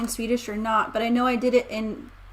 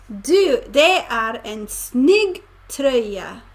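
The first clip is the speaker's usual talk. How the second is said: slow learner Swedish; slower than usual. Shown as a demonstration.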